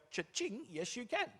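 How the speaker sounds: high-pitched mock till ring; gleeful shop owner. Business owner agreeing to sell to a customer, gleeful. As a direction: chime it high, pleased with the sale.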